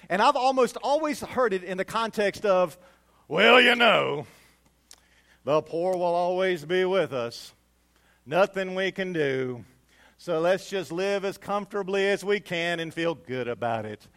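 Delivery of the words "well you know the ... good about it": resigned tone. Retold or imitated. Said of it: "in this tone of resignation".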